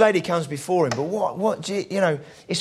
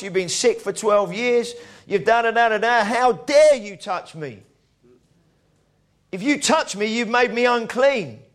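scornful rebuke; 5 semitones higher than usual. Retold or imitated. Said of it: "he could have said to her".

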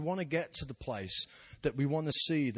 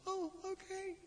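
much higher, timid "oh, okay"; a small, deflated giving-in; squeaky meek reply from the girl; meek little voice; 6 dB quieter, slower than usual. Retold or imitated. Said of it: "she goes".

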